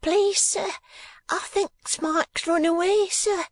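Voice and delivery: put-on high piping child voice; shrill little boy. A narrator's reading. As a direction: pipe it up like a tiny schoolboy. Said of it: "one shrill voice".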